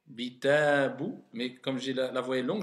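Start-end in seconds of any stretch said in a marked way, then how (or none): 0.1-1.2 "Bitabu" slowly, every vowel held long. Shown as a demonstration. Teacher modelling long vowels for the pupil. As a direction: stretch each vowel of "Bitabu". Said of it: "je dis bitabu".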